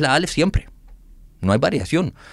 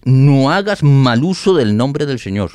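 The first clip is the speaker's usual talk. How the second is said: slow, louder reading of "no hagas mal uso"; emphatic quoted verse; 3 semitones higher, 7 dB louder, slower than usual. Shown as a demonstration. Quoting a Bible version to listeners, slow emphatic reading. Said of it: "la Dios habla hoy ... es".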